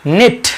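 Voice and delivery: "निट" beginning straight on the N; silent K. Shown as a demonstration. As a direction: say "निट" with no K sound. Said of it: "यहाँ भी के silent है".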